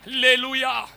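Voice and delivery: loud, driving prophetic preaching; full-force preaching. Acted. Pastor declaring prophecy to the congregation at full force. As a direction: thunder the prophecy over the church.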